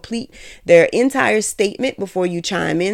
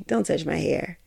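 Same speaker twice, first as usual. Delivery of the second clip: soft, sweet and much quieter, smiling through it; said with a smile; 8 dB quieter than usual. Retold or imitated. To someone reaching for her hair, sweetly smiling and soft. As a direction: ask nicely with a smile, barely above a murmur. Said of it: "even if they smile and say".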